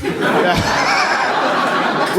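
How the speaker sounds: ordinary talk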